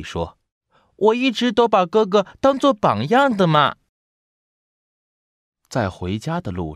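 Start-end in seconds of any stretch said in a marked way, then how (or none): 1.0-3.8 put-on high, loud kid voice; small child's high, earnest voice; little brother voice. A narrator's reading. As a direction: speak as an admiring little brother, high-pitched.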